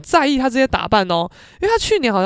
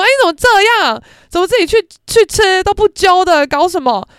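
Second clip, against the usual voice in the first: much higher, louder indignant outcry; loud protest; 9 semitones higher, 8 dB louder than usual. Retold or imitated. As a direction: cry out in mock outrage. Friends loudly protest being left out.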